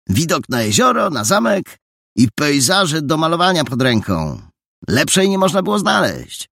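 put-on a smart-mouthed village boy selling the lodgings; cheeky boy voice.

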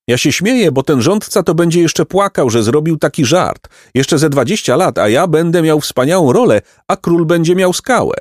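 put-on high, bright-hearted old peasant voice; cheerful peasant. A narrator's reading. Peasant reassures the two travelling boys, high and kindly.

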